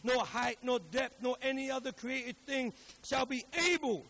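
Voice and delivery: emphatic preacher's Bible reading; declaimed scripture. Acted. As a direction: build the list phrase by phrase, projected.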